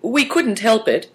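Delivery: high, apologetic explanation. A narrator's reading.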